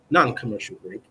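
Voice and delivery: ordinary delivery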